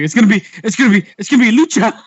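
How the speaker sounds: high, loud, rushed promo imitation; mock flustered wrestler promo; Kalisto. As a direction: stammer it out fast, building to a shout. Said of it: "remember Kalisto's promo where it's like".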